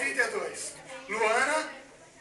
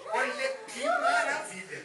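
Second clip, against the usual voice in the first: rindo empolgada; 13 semitones higher than usual. Real, not acted. Auctioneer delighted by the item.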